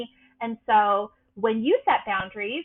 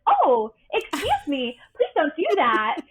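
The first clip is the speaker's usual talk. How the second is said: Barbie voice; 5 semitones higher, 6 dB louder than usual. Shown as a demonstration. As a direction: play the sweet sunshine personality, pitched up. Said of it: "Barbie voices of the world".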